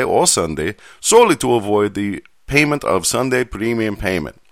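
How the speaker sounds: badly faked Nigerian accent, lilting; attempted Nigerian accent. Shown as a demonstration. Host reading contract article aloud, attempted Nigerian accent. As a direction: read it formally in a shaky attempt at Nigerian accent. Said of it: "I'm going to try my favoriteest accent in the whole world, the Nigerian accent".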